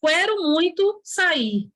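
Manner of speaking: "Quero" as "kwé-ro", sentence drawn out slowly; slow mock example with mispronounced "Quero"; wrong, u sounded. Shown as a demonstration. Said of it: "Ninguém fala quero".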